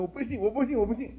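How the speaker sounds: high, loud mock protest of a stubborn man; mock stubborn "I don't believe"; stubborn refusal. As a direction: play the unbeliever digging in his heels. Mimicking stubborn unbeliever.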